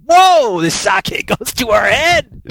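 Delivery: high, loud woozy exclamation, slowed and laughing; tipsy whoa. Retold or imitated. Climbers feeling the sake at altitude, woozy and laughing. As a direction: let the drink hit your head.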